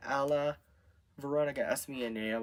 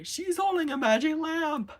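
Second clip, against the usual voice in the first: high, louder cry of discovery; excited discovery; 5 dB louder than usual. A narrator's reading. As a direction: exclaim it in a very high character voice. Acted discovery by story characters.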